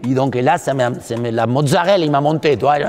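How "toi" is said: ordinary talk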